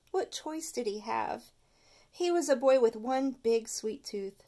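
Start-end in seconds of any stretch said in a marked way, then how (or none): none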